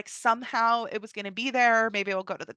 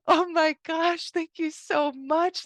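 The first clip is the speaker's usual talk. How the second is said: breathless thanks; 7 semitones higher, much slower than usual. Retold or imitated. Girl returns her lost Kindle.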